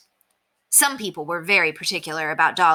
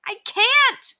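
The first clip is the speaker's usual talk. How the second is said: very high, helpless cry; 4 dB louder than usual. A narrator's reading. New neighbor answers the shouting crowd, crying out high. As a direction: cry out in a fluster. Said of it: "cried the new neighbor".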